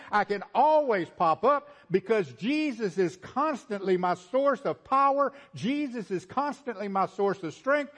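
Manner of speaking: high, driving pulpit cadence, building by repetition; impassioned preaching. Real, not acted. Preacher declaring he can always pop up, full-force preaching. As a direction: hammer each repeated phrase home.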